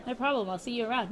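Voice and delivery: quick, easy-going goodbye; casual demo. Shown as a demonstration. Teaching Hana a casual goodbye, quick and breezy. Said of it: "This is what you say".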